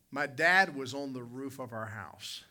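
ordinary talk, nothing marked

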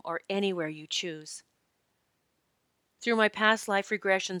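soft, measured promo voice; calm, polished course advert; scripted ad read. Real, not acted. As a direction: read the promo softly and evenly.

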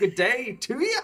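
mock Australian greeting, slow and high; Australian accent. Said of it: "that was your Australian".

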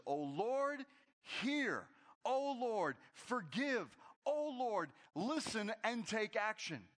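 high, loud pleading cry to God; a preacher voicing an impassioned plea; fervent prayer. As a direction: cry out to the Lord with urgency. Quoting Jeremiah's fervent prayer.

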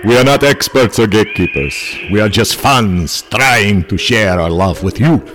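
a campy Count welcoming guests to his castle; Dracula accent. Acted. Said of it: "I'm not gonna do an accent".

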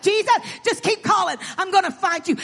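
acted blind man's loud call. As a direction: call out eagerly as you search for him. Acting blind man calling to Jesus.

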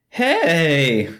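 high, squeaky middle schooler with a cracking voice. Pretend. Podcaster mimicking offended middle schoolers, voice cracking. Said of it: "Voice cracking".

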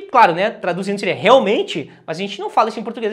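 ordinary delivery

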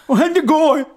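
very high, loud mushy wail; swollen-tongue panic. Retold or imitated. Pierced man asking his sleepy friend, panicked garbled wail. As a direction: repeat it desperately with a thick tongue. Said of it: "Så jag kan ju inte prata".

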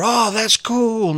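put-on high, slow, wide-eyed kid; excited kid. Speaker voices a kid marveling at a discovery, high and slow. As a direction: stretch it out in a high, amazed kid voice.

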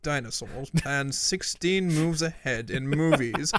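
attempted British accent for Kasparov. Podcaster voicing Kasparov offering his cheek swab, mock British accent. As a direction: put on a mock British accent, drawn-out pleading at times. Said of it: "I did a British accent".

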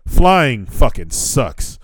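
slow, every word leaned on; drawn-out complaint. Real, not acted. Traveler venting to the camera, slow drawn-out complaint. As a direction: drag out the gripe slowly. Griping about the flight.

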